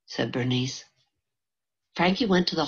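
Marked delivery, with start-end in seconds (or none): none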